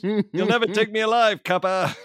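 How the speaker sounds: high, put-on 1930s movie crook voice; hammed-up old-movie gangster defying the police; old-timey gangster. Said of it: "everyone just keeps talking like this now".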